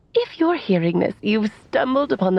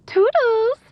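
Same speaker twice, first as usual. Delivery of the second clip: playful goodbye; 12 semitones higher than usual. Acted. Playful sign-off on recording.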